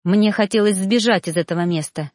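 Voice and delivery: plain reading voice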